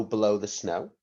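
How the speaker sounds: ordinary talk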